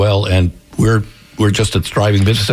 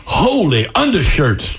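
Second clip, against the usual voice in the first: higher, drawn-out exclamation in character; startled gasp; 5 semitones higher, slower than usual. A narrator's reading. Old man just missed by an arrow, startled exclamation. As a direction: exclaim it slowly in a raised, startled voice. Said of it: "Charlie gasped".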